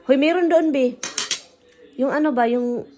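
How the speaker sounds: loud call. Real, not acted. Calling partner excitedly.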